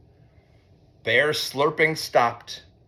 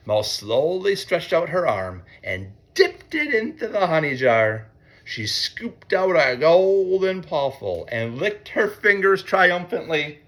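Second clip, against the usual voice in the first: ordinary delivery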